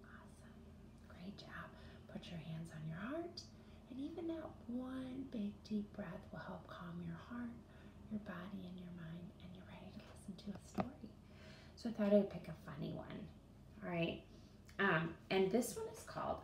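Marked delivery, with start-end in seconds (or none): 0.1-10.9 soft unvoiced whisper to settle kids; calm whisper. Teacher whispering to children to calm down. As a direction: hush them gently before the story. Calming breath lead-in.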